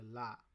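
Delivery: লা with an l sound in place of রা; Japanese la for ra. Shown as a demonstration. Teacher shows Japanese saying la for ra. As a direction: say লা, not রা. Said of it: "ওরা লা বলে".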